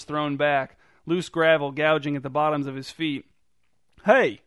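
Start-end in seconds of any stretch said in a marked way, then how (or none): 4.0-4.4 loud, high, striking call across the alley; bold shout. A narrator's reading. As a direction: shout it out boldly to stop a mugger. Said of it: "he shouted".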